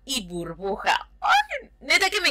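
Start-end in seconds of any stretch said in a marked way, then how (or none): 1.2-2.3 high squeal of delight; little fangirl squeal. Real, not acted. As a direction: squeal at the cute drawings. Fan squeals over cartoon style.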